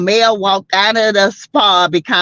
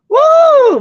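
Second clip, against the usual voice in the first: a sudden high, loud whoop; excited whoop; 6 dB louder than usual. Real, not acted. Host whoops at the drama on screen, excited. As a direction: let out a big celebratory woo. Hyped reaction while watching show.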